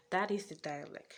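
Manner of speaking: ordinary talk, nothing marked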